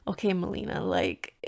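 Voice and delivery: a soft mock self-lecture under the breath; inner voice. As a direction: quietly scold yourself in your head. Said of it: "I was thinking".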